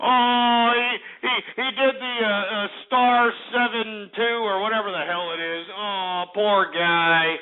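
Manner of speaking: drawn-out gloating lament; taunting sympathy that sinks into weary scorn; mock pity. Real, not acted. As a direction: stretch the words slowly to jeer at him. Gloats that caller blocked him.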